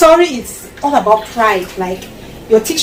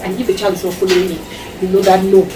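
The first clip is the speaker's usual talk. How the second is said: ordinary delivery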